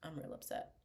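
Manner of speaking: low, sulky murmur behind a veil; pouty upset mourner. Pretend. Mock mourner under a veil, sulky. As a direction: sulk quietly, hidden under a veil. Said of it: "giving face".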